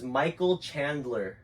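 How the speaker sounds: slow, exaggerated mouthing; over-articulated. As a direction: spell it out with big lip movements. Mouthing names for lip reading.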